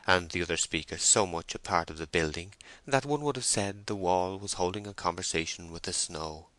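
usual speaking voice

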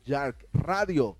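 announcer voice. Acted. Station ID.

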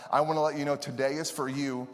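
usual speaking voice

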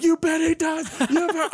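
high-pitched excited fan yell; squealed, hyped-up answer; screaming. Retold or imitated. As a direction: reenact screaming back at the TV. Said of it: "I'm screaming".

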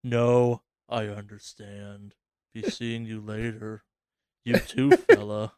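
a light sinister sign-off; hint of creepy van guy. Keeping up the creepy voice after the van line. Creepy character keeps talking.

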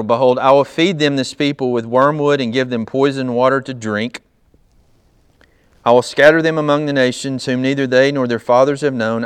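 loud, forceful Bible reading; scripture reading. Real, not acted. Reads scripture aloud to congregation.

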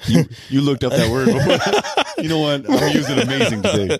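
teasing through laughter; laughing. Real, not acted. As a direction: laugh while you tease. Teasing host about his word.

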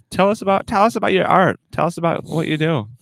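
high, eager talk-show host voice; mock interviewer. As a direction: ask it like a gushing interviewer. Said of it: "And I'll be like".

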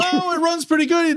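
high, pleading imitated kid; defensive kid. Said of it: "He was like".

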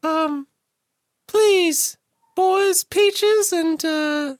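very high, slow falsetto plea; pretend Isabella. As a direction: plead like a damsel in falsetto. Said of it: "pretend to be Isabella".

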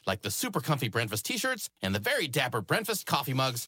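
bright, quick promo pitch; upbeat ad read. Acted. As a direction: sell the merch like a radio spot. Host reads a sponsor plug.

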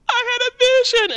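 very high wailed retelling; overwhelmed child. Retold or imitated. Child telling mother of vision before crying, high wail. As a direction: blurt it like a kid about to cry.

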